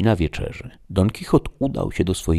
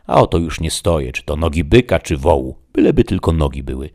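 loud, emphatic Sancho; raised voice, eager and insistent; hungry squire, insistent; 2 semitones higher, 7 dB louder than usual. Acted. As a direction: stake the claim forcefully. Sancho claims the dish.

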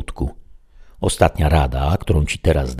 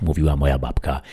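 ordinary delivery, nothing marked

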